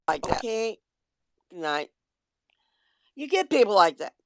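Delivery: normal talking voice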